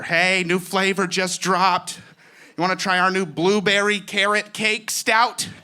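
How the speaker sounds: slow, high, loud hipster pitch; mock craft beer hipster; hipster brewer. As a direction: upsell like a smug brewer.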